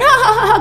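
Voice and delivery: high mock radio laugh; demonstrated hearty laugh. Radio host laughing alone in the studio, high put-on laughter. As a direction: laugh away merrily, as if in company. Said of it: "ketawa asik".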